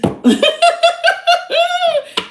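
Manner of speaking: a sudden high laugh breaking the tension; high release laugh. Real, not acted. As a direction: laugh it off high after tears. Laugh after tearful reading.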